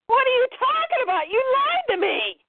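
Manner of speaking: put-on panicked boy protesting; high agitated shout. Acting out a heated comic scene.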